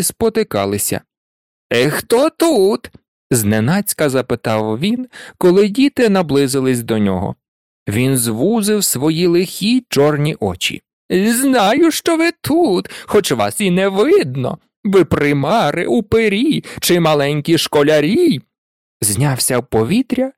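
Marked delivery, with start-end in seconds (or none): 1.7-2.9 high, drawn-out, taunting call; menacing imp voice, pitched up; mischievous poltergeist. A narrator's reading. 11.1-18.4 high, teasing imp voice; sly singsong menace; mischievous poltergeist. A narrator's reading.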